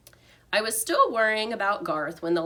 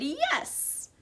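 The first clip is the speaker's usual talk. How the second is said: excited squeak; 9 semitones higher, 3 dB quieter than usual. A narrator's reading. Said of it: "an excited".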